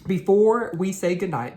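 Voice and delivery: usual speaking voice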